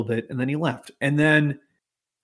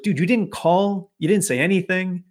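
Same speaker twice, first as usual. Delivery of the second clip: lightly acted manager objecting, higher pitch; mild mock protest; 6 semitones higher than usual. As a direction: push back in a higher voice, mildly incredulous. Manager's retort to angry customer.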